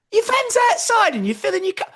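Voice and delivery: high, mocking disbelief; incredulous teasing. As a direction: rib him for being cheap. Friend mocking his cheapness.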